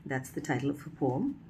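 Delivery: ordinary talk, nothing marked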